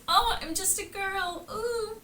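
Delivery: high-pitched, feigned-innocent simper; mock helpless girl. Betty pretending she's just a girl, mock helpless. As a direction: play up the helpless act. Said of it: "She's like".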